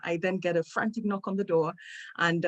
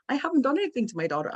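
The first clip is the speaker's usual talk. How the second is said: indignant protest; 7 semitones higher, faster than usual. Retold or imitated. Defending herself to accusing staff.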